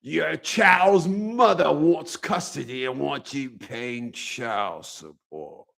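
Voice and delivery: the court telling a father about custody and support, in a slow ominous Emperor voice; Sith-style judge voice. Retold or imitated. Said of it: "talking to you like that".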